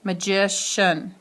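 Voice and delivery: "magician" slowed, weight on the second syllable; stress on magician's middle syllable. Shown as a demonstration. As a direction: draw out the "gi" in "magician". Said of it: "it's no longer on magic".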